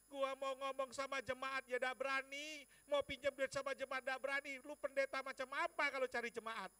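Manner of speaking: loud, high self-reproach; exasperated rant. Retold or imitated. Pastor afraid to borrow from congregation, exasperated loud rant.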